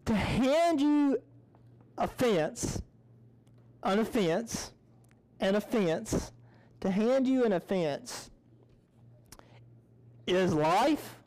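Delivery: drawn out, each phrase set apart; slow and deliberate. Shown as a demonstration. Speaker stresses the point slowly to the class. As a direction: lay it down slowly for the audience. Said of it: "let me say it like this".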